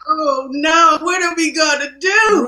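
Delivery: loud, high cry of alarm; retold panic. Producer reliving losing the director before production, loud panic. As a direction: wail it in mock panic.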